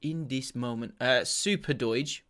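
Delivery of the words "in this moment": put-on tame Portuguese accent, understated; Jose Mourinho. Podcaster imitating a manager's catchphrase, mild Portuguese accent. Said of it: "in his tame Portuguese accent".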